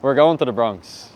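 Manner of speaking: shouting. Real, not acted. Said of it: "shouting already".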